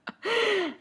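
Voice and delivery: short high burst of laughter. Real, not acted. Remembering her crying husband scene, high laugh.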